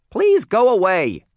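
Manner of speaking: very loud, high dismissal; scowling owner's shrieked brush-off; rude restaurant owner. Acted. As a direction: shout the visitor out, high-pitched.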